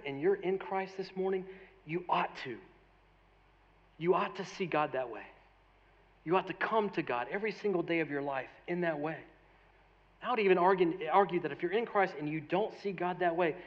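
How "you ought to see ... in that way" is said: ordinary talk, nothing marked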